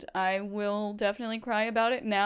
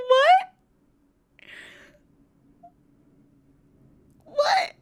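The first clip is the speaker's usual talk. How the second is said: high, loud squeals of What; repeated shrieking disbelief; stunned disbelief; 8 dB louder than usual. Real, not acted. Streamer reacting to a celebrity follow, shrieking in disbelief.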